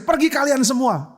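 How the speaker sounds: high, drawn-out shouted order; driving out. Shown as a demonstration. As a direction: throw everyone out of the room. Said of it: "Usir ya usir". (mengusir dengan seruan lantang)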